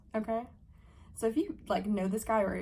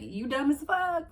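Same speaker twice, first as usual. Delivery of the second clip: high, loud, slow mocking taunt; 6 semitones higher, 5 dB louder, slower than usual. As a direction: sneer it at him. Taunting laughing voice.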